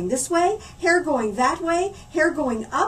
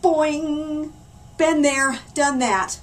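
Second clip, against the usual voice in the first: moaning; 3 semitones higher, 4 dB louder, much slower than usual. Acted. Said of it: "moaned Oliver".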